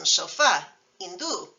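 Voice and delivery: sofá and hindú stressed on the last vowel; final-vowel stress. Shown as a demonstration. Teacher giving exceptions, final-vowel stress. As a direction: land on the end of sofá, hindú. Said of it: "the stress falls on a final vowel".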